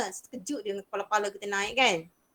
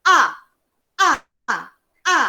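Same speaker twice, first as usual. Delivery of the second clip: abrupt falling A; fourth tone, angry-sounding; 5 semitones higher, 9 dB louder than usual. Shown as a demonstration. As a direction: drop A sharply, as if scolding. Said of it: "Dia marah".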